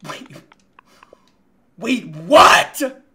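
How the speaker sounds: loud, incredulous double take; startled disbelief. Real, not acted. Gamer reacting to an odd group post, startled disbelief. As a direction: blurt it out as a loud, stunned question.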